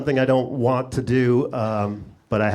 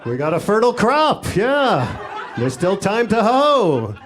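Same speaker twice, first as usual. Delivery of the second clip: Iowa farmer; 4 semitones higher than usual. Acted. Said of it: "they kinda sound like farmers in Iowa".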